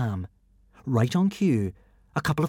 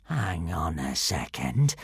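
lower, slow wary Alvin; suspicious villain; 7 semitones lower, 4 dB quieter, slower than usual. Acted. Alvin noticing the shirt, lower and suspicious. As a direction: drawl it warily.

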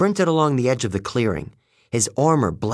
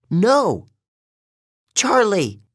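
high-pitched dismayed cry; contained narrator's yell, pitch leaping up; 9 semitones higher, 3 dB louder, much slower than usual. A narrator's reading. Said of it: "Selena yelled".

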